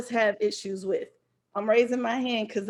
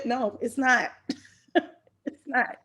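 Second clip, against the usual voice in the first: normal talking voice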